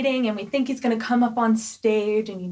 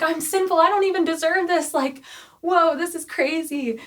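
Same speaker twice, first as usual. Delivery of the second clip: awestruck Simon; 6 semitones higher than usual. Retold or imitated. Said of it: "And he's like".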